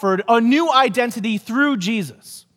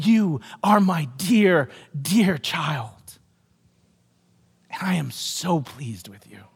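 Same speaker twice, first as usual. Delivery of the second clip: slow, soft, much lower and quieter; tender voice of God; 6 semitones lower, 4 dB quieter, slower than usual. Retold or imitated. Preacher voicing God to each believer, tender and hushed. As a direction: gently reassure a beloved child. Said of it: "God is looking at you and saying".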